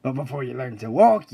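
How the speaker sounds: normal talking voice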